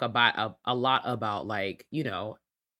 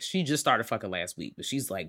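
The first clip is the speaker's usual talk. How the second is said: ordinary talk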